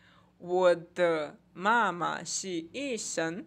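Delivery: "我的妈妈是医生" read slowly and loudly in clear Mandarin; slow Mandarin demo. Shown as a demonstration. Teacher to students, reading the example slowly and loudly. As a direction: spell out "我的妈妈是医生" slowly for the class. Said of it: "nossos exemplos aqui".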